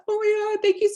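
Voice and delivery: imitated tearful thanks, very high; tearing up. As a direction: squeal out mock-tearful gratitude. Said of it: "She's, like, literally tearing up".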